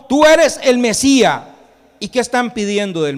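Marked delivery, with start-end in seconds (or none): 0.1-1.4 loud, high acted shout; forceful preacher's cry; at full lung. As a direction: belt it out as a declaration. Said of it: "a todo pulmón".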